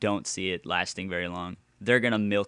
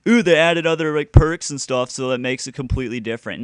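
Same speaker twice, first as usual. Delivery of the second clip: mock excited fan; 3 semitones higher, 7 dB louder than usual. Mocking fans' praise.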